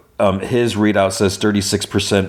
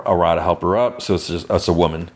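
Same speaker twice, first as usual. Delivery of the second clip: ordinary talk, nothing marked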